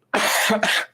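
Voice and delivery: breathy leftover cough; small cough mid-sentence; coughing up water. Real, not acted. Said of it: "So sorry about that".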